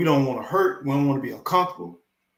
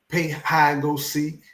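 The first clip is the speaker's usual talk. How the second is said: usual speaking voice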